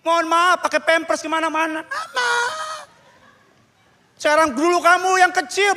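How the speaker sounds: high, sustained pleading preaching; impassioned exhortation. Real, not acted. Preacher urges the newly married to care for parents, high and pleading.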